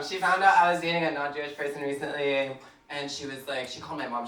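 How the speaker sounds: ordinary talk, nothing marked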